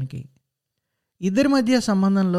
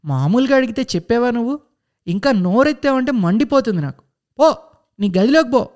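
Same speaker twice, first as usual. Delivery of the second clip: higher, louder furious order; angry shout; 5 semitones higher, 4 dB louder than usual. Acted. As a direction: send him off yelling. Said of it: "అరిచింది ఆమె". (కోపంతో అరుస్తూ గదిలోకి పంపడం)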